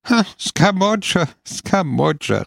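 Scamoggia tossed out high and laughing; amused mock echo of Scamoggia. A narrator's reading. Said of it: "rief lachend die Freundin".

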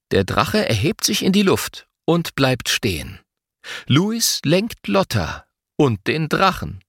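ordinary delivery

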